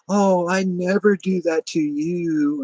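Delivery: high, drawn-out imitation of feigned innocence; mock innocent denial. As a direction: mimic the neighbor protesting, slow and exaggerated. Imitates neighbor's denial of screwing him.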